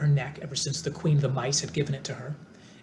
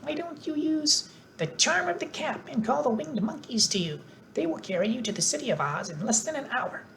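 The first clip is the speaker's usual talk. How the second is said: squeaky mouse queen; 11 semitones higher than usual. A narrator's reading. Queen of the Mice.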